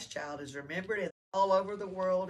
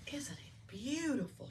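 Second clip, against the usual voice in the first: soft wonder; 8 semitones higher, 5 dB quieter than usual. Acted. Mary marvels at web softly.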